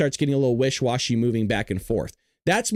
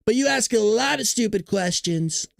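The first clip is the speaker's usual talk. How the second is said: slow, higher-pitched imitation of a politician's put-down; Donald Trump; 5 semitones higher than usual. President answering a reporter's question, mock Trump voice. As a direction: mimic his dismissive cadence, unhurried. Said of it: "she asks him the question".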